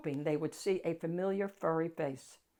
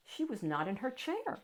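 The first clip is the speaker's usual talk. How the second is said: usual speaking voice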